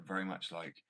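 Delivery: ordinary talk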